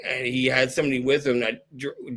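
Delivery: normal talking voice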